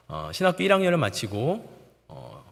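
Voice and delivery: usual speaking voice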